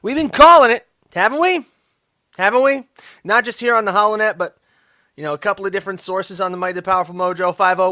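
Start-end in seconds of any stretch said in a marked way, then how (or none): 0.0-4.4 emphatic. Real, not acted. Emphatic claim to audience.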